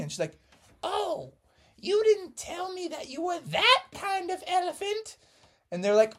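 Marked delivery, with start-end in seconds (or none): 0.8-5.2 high, squeaky cheerful mom voice; giddy team mom. Acted. Missus Frogerson cheering the boys on, high and giddy.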